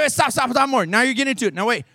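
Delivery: normal talking voice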